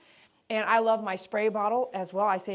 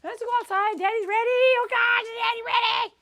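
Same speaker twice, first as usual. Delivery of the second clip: much higher, louder sing-song cooing; 8 dB louder than usual. Real, not acted. Groomer gushing to the happy dog that daddy arrived, high baby talk.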